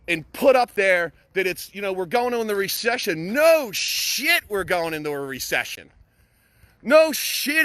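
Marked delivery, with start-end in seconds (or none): 3.3-4.4 loud, high scoff; sarcastic no shit. Real, not acted. Mocking social media doom posts, loud sarcastic scoff. 6.8-7.6 loud, high scoff; sarcastic no shit. Real, not acted. Mocking social media doom posts, loud sarcastic scoff.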